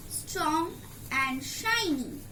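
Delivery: usual speaking voice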